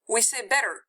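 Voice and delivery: ordinary delivery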